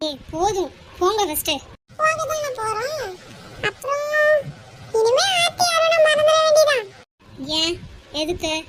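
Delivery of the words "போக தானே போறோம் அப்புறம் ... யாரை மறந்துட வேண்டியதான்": high, drawn-out taunt; goading, pitched right up. Acted. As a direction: needle a rival in a much higher voice. Rival taunts women on the street.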